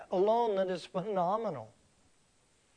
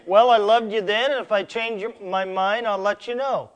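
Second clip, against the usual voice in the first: careless husband; 4 semitones higher, 7 dB louder than usual. Pretend. Husband voiced dismissing his wife.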